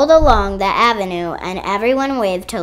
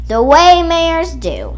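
mayoral speech; 8 semitones higher, 7 dB louder, slower than usual. A narrator's reading. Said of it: "gave a speech".

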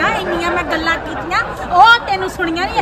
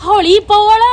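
a loud shushing command; loud hush; 2 semitones higher, 10 dB louder than usual. Acted. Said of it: "ਕੰਨਾਂ ਵਾਲੀ machine ਘਰੇ ਰਹਿ ਗਈ".

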